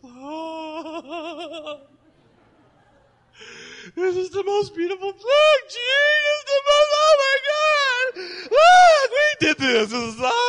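very high, loud and tearful rapture; ecstatic joy. Retold or imitated. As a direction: gush over the newborn through laughing tears. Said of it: "All of a sudden she goes".

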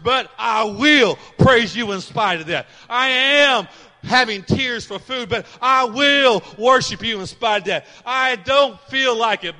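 slow, loud preaching shout; defiant proclamation. Acted. Preacher voicing the psalmist standing up to the bully, shouting slowly. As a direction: roar it out as a defiant vow. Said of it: "See, he's choosing".

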